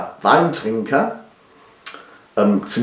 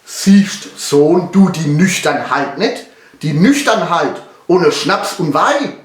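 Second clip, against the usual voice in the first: lightly acted anxious father, raised pitch; worried father; 6 semitones higher, 4 dB louder than usual. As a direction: plead a little with your son in Pfälzisch. Drunken father voiced in ballad. (leicht gespielter besorgter Vater)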